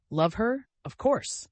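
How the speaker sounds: high, pleading insistence; plaintive emphasis. A narrator's reading. Sonia insists she loves her stepmother, plaintive. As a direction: plead it as if the question hurts. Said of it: "said Sonia with plaintive emphasis".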